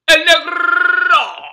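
slow stretched booming name call; lucha libre announcer. Pretend. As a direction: drag out the name like a ring announcer.

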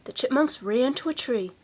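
usual speaking voice

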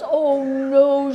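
loud hammed-up drunk protest; loud mock protest. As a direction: play the drunk star crying out, loud and hammy. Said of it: "she says".

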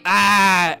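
loud cut-in "ah"; exasperated yell. Real, not acted. Player blocked from doing an emote, yelling exasperatedly. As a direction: break off into a loud yell of frustration.

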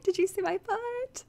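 high, slow, silly repeat; exaggeratedly high drawn-out question; echoing own weird delivery. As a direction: mimic your odd question, stretched out. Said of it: "I said that kind of weirdly".

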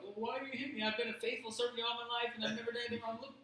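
a loyal animal asking why; hurt donkey. Retold or imitated. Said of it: "donkey's like".

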